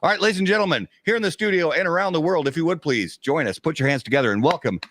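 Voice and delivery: announcer welcome, building up the crowd; show intro. Acted. Host welcoming studio and world, announcer style. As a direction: hype the audience like a host.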